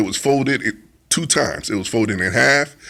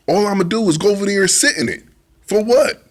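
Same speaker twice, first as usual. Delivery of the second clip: high, fast, fed-up question; exasperated; 7 semitones higher, faster than usual. Retold or imitated. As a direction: scoff at the pointlessness. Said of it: "I'm like".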